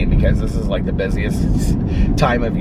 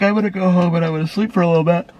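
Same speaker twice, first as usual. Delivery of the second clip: quick, higher mimic of her friend; retelling the friend's reply in a fast, higher mimic; imitating friend; 10 semitones higher, faster than usual. Voicing friend's plans.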